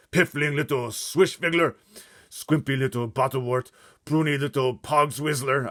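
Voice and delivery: loud booming giant insult; shouting giant. Acted. Blood Bottler insulting the BFG, shouting. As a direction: bellow the insults.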